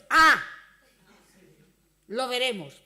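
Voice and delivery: loud, doubting mock retort; a loud mock skeptical ah, we'll see; sceptical "we'll see". Preacher imagines doubting a returning member, loud and mocking. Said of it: "Podía haber dicho".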